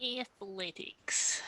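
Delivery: slow breathy whisper. Real, not acted. Player whispering the skill name to the game master, slow whisper. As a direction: hush it out slowly.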